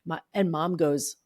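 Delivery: ordinary delivery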